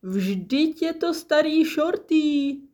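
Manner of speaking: high, hearty recognition; booming greeting. A narrator's reading. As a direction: hail an old friend with gusto. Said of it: "zaburácel".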